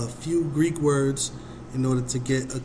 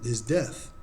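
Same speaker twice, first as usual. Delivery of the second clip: ordinary delivery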